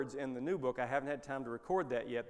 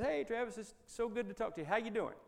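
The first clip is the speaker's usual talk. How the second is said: pitched up, friendly greeting; cheerful phone hello; 8 semitones higher than usual. Retold or imitated. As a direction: sound glad to hear an old friend. Retold own cheerful reply.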